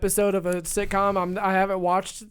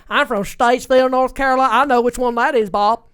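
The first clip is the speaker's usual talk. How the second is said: imitating Southern accent; 4 semitones higher, 6 dB louder than usual. Quoting the Statesville contestant to Bob, mock Southern accent. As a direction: play the Southern game-show fan, lightly. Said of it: "he's like".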